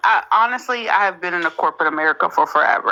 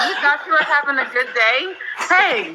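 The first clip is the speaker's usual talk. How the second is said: laughing, higher mock taunt; 8 semitones higher than usual. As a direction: tease a listener while laughing. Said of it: "we'll be like".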